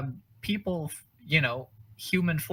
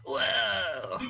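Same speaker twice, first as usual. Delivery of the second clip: awed whoa; 6 semitones higher than usual. Pretend. Awed by the meteor.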